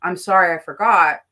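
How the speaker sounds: tossed-off "I'm sorry I forgot", said quick and offhand; shrugging "I'm sorry I forgot"; careless apology. Shown as a demonstration. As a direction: brush it off like it's nothing. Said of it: "You need to say".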